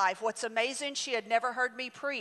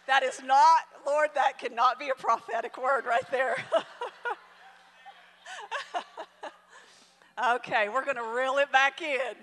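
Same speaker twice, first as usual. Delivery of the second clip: laughing through words; 2 semitones higher, 3 dB louder than usual. Real, not acted. Preacher still laughing as she calms the congregation, laughing through words. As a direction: talk through your laughter.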